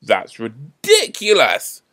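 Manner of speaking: loud, slow, drawn-out scoff; indignant onlooker's loud protest; angry. A narrator's reading. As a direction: stretch it out in outrage. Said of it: "said someone else angrily".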